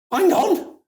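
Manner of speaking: high, loud, incredulous; baffled protest. Retold or imitated. Owner faced with ashes and a live cat, high baffled protest. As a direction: protest high and comically flustered. Retold bafflement over two cats.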